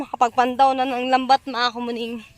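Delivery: ordinary talk, nothing marked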